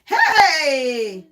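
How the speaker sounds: loud laughing hey; excited laughing shout. Real, not acted. Reacting to Lily's comment, a loud laughing hey.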